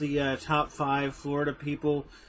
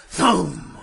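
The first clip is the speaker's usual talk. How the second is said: loud imitated thump; dull thud; 5 semitones higher, 9 dB louder than usual. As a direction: make a heavy thud noise. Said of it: "you just sit down like".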